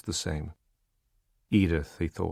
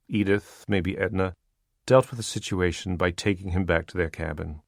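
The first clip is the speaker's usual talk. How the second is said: ordinary delivery, nothing marked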